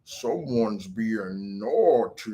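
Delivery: low, very slow, drawn-out monster voice; menacing Krampus. Retold or imitated. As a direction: draw out each word like a creepy child-snatcher. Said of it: "and he would be like".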